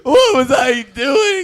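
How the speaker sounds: loud, slow, wailing cry; hammed-up howl of remorse; anguished shout. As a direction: bellow the regret to the heavens. Host breaks down over shaming Buddha.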